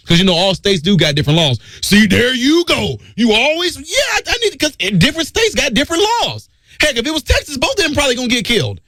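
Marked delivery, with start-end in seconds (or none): 1.8-8.8 higher voice, a touch of ridicule; lightly mock the other side; mock accuser. Mocking voice of the accuser.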